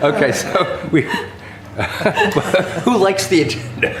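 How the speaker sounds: giggling through the words; can barely hold back laughter; laughing. Real, not acted. Chair laughing at the vote joke.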